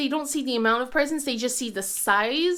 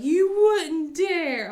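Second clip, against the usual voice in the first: slow, louder challenge; daring challenge; 5 semitones higher, 6 dB louder, much slower than usual. Retold or imitated. Kids challenging mom's joke, slow daring tone. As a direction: dare her, drawn out. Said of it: "they were like".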